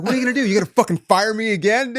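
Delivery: high, loud taunting yell; mocking shout. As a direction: dare them to fire you, at full volume. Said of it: "I went out guns blazing".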